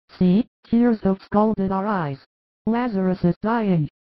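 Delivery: grieving sisters. Acted. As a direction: sisters dazed with grief, near tears. Grieving sisters tell Jesus.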